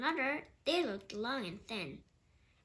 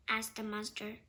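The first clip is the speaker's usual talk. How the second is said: ordinary delivery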